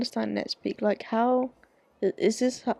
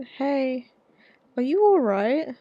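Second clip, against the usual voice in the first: concerned dad; 4 semitones higher, 6 dB louder, much slower than usual. Acted. Dad checks on crying daughter.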